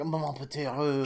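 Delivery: mocking French repeat; a mock French line, lightly done; French accent. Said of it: "She's just like".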